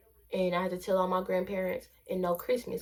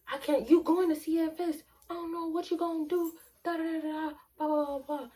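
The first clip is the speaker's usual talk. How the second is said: mock parental scolding; 7 semitones higher, faster than usual. Acted mother scolding in retold story.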